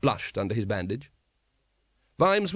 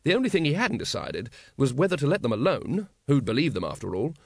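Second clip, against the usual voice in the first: usual speaking voice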